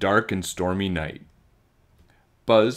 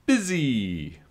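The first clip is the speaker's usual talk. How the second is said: high, buzzing little fly; buzzy fly voice; 6 semitones higher than usual. A narrator's reading. Fly answering the boy at bedtime, high and buzzy. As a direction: buzz out Busy like an insect. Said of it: "Fly Guy said".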